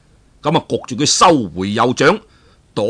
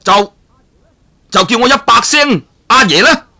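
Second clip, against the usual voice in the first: much higher, louder, arrogant demand ending on a rising 啦; haughty demand; 9 semitones higher, 5 dB louder than usual. Acted. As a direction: lord it over him, demanding 阿爺. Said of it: "馬上就轉口咯". (慕容復高傲咁要人叫阿爺，尾音上揚)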